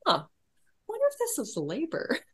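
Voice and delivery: high, puzzled, mock-innocent musing; naive wondering. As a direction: play up your own past cluelessness with a rising, wide-eyed lilt. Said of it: "And I'm like".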